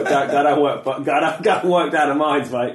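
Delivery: a loud, laughing, hammed-up Australian accent; a bloke barking at you to go down the mines, in a broad Australian accent. Interviewer imitates an Aussie school counsellor in a laughing Australian accent. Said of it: "it's a terribly Australian accent".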